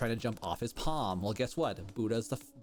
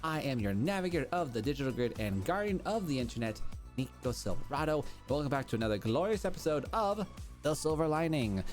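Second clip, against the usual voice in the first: show host intro; 6 semitones higher than usual. Acted. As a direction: welcome listeners like a radio host. Podcast host opening the show.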